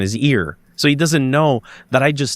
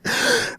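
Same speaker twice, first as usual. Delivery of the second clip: sharp gasp, mimicking her shock; a quick retold gasp of shock; imitated gasp; 5 dB quieter than usual. Imitating Sarah at the photo, sharp gasp. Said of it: "She went".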